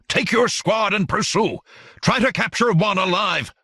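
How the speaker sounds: high-pitched shouted orders; commanding shout. A narrator's reading. Count orders his squad to pursue the enemy, shouting. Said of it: "shouted the Count".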